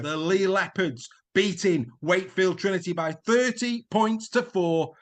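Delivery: big rising sports-highlights commentary, a few shouts; excitable commentary. Acted. Presenter calling the highlights reel with hammed-up excitement. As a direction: sell every score like the match of the year.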